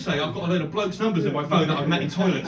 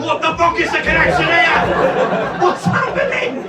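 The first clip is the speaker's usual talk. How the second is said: higher, louder, slow baffled cry; bewildered outburst; 11 semitones higher, 8 dB louder, slower than usual. Retold or imitated. Comic watching the girls' table, bewildered cry. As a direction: drag out the confusion in disbelief. Comedian's own bewildered reaction.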